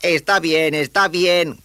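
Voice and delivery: high-voiced youngster, put upon; reluctant boy giving in. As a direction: give in loudly, pitched well up. Roused boy gives in to grandmother.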